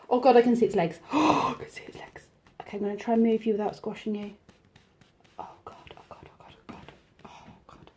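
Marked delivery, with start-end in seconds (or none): 1.1-2.3 terrified hushed whisper; frightened whisper. Real, not acted. Spider legs seen up close. 5.4-7.9 panicked whispered oh God; terrified whisper. Real, not acted. Moving the spider.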